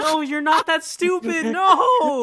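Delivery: high, loud, drawn-out disbelief; incredulous outcry. Real, not acted. Listener to storyteller about naive friend, incredulous wail. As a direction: wail at how foolish it is.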